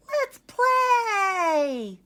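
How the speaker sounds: high, loud puppy shout of joy; excited cry. Acted. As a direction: squeal it out like an eager puppy. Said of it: "cried Ollie".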